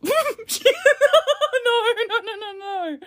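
high squeal of laughing no; cracking up, high no no no. Real, not acted. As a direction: shriek through the laughter in disbelief. Shrieking laugh of disbelief.

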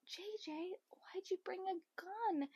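unvoiced whispered copy. Whispering their question to copy the cast. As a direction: whisper their line back. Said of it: "I'm like".

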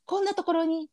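hammed-up Kansai narrator, pitched high; mock a cheesy comedy-show narrator; cheesy Shinkigeki opener narration, high and overdone; cheap narrator voice. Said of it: "みたいなやつやろ？".

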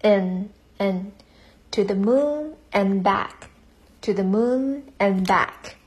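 slow model reading, "and" reduced to "N" with no d; weak form of and. Shown as a demonstration. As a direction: draw out "to the moon and back", saying "and" as just "N". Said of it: "你可以只读它的弱读形式".

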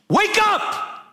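high, explosive wake-up yell; sudden shout. Acted. Preacher jolting the congregation awake, sudden shout. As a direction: burst out with it to jolt the listeners.

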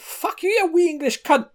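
high, loud mock Scottish brat scream; Scottish kid yelling. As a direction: shriek it like a foul-mouthed Scottish twelve-year-old. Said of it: "He went".

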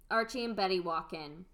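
ordinary talk, nothing marked

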